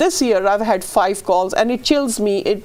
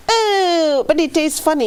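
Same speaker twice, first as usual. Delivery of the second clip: put-on high voice of reluctance; mock disgusted complaint; 6 semitones higher than usual. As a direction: imitate a squeamish complainer, pitched up. Voicing people's complaint about taste.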